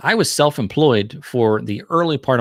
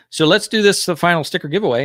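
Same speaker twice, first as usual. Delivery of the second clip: excited; 8 semitones higher, 3 dB louder than usual. Real, not acted. Host announcing stream giveaway.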